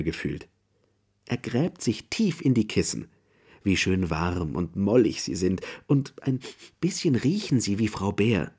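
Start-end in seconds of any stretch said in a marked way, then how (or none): none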